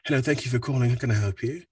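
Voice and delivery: light British accent phone greeting. Shown as a demonstration. Bank agent answering callers in a British accent. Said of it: "a bit of a British accent".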